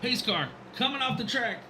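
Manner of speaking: high, urgent race call; live play-by-play. Real, not acted. Announcer to viewers as pace car leaves, live play-by-play. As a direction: call the restart with rising anticipation.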